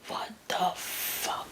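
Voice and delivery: slow, breathless whisper under the breath; whispered curse. Retold or imitated. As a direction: hush it like you're hiding. Hiding low in the car seat.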